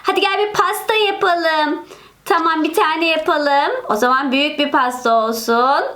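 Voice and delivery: usual speaking voice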